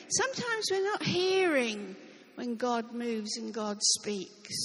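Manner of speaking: ordinary talk, nothing marked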